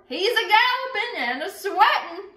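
high, loud, excited cry with a laugh; hollering the news with glee; excited lookout. Acted. Lookout hollering news of the rider to the town, excited.

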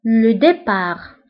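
départ said slowly as depar, with no final t; départ ends on the r, the t stays silent; slow départ with the final t dropped. Shown as a demonstration. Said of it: "كنكتبوها بالت في آخر الكلمة ولكن لا تنطق".

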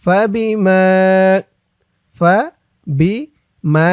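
فبما drawn out slowly, with the long ما held; slow syllable demo. Shown as a demonstration. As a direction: sound out فبما syllable by syllable, stretching ما. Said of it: "ভেঙে ভেঙে সঠিক উচ্চারণ করে বলে দিব". (ধীরে ধীরে ভেঙে فبما উচ্চারণ, মা টেনে)

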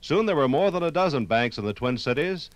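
ordinary talk, nothing marked

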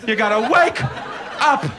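high, whiny complaining mock voice; mock a snooty cat owner whining; whiny cat person. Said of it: "That's what cat people always say".

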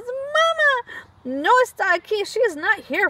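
high crying call for mama; high-pitched cry of Mama; shouting for mama. Acted. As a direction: call out for a missing mother. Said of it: "Froggy shouts".

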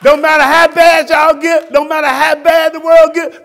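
loud, high rebuking sermon; fervent preacher shout; preaching at full force. Real, not acted. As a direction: scold the church with fire. Preacher climax.

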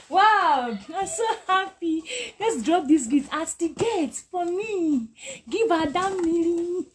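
real happy crying over a gift; overjoyed. Real, not acted. Teacher thanking a viewer for a gift, high slow tearful joy.

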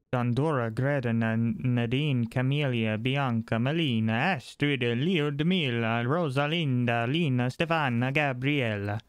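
put-on lilting, singsong Italian accent. Gamer reads a list of names aloud in an Italian accent. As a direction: roll through the names like an Italian man. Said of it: "I developed an Italian accent".

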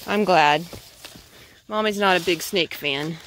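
ordinary talk

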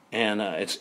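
ordinary talk, nothing marked

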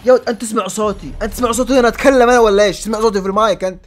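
loud, high yell at another player; shouting. Real, not acted. Gamer yelling at a rival player over the mic, shouting. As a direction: bellow it so they hear you over the mic.

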